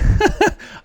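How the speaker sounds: whispery burst of laughter; an embarrassed airy laugh; breathy laugh. Real, not acted. Laughs after noticing loud volume.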